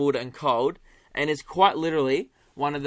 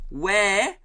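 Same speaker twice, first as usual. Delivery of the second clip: loud, very slow, word by word; hammed-up volume to beat a language barrier; mock tourist yelling; 4 semitones higher, 6 dB louder, much slower than usual. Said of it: "they just start yelling".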